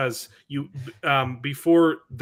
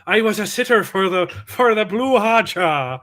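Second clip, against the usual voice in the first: put-on Irish accent; 4 semitones higher, 6 dB louder than usual. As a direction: try an Irish lilt. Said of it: "try it with the Irish accent".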